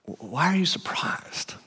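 hushed, unbothered whisper; calm whisper. Retold or imitated. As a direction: say it totally chill, barely voiced. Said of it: "He's like".

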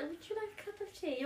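high, soft sugary politeness; hammed-up polite host; mock polite offer. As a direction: offer tea with exaggerated sweetness. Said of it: "Why would you be like".

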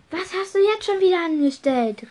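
high-pitched exclaimed question; indignant call. A narrator's reading. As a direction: cry out in reproach. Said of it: "rief Draco".

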